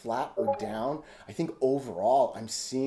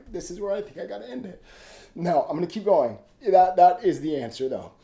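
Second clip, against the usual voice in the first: tearful; 6 semitones higher, 8 dB louder, faster than usual. Real, not acted. Grieving, pushing through tears.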